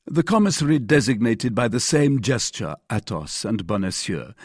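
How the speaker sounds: ordinary delivery, nothing marked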